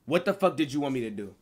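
usual speaking voice

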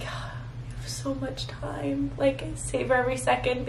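put-on hushed, wistful whisper to younger girls; whispered advice. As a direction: whisper it like a secret you wish you'd known. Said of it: "I was like".

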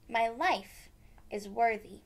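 ordinary delivery

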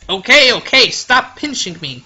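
loud protest. Acted. Being pinched by Shirley, loud pleading protest. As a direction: beg her to stop, loud.

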